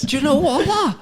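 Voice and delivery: high-pitched, hammed-up Scouse accent; botched Scouse accent. Scouse lad answering the protester, in high mock Scouse accent. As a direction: push the bad Scouse up high. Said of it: "finish it with the accent now".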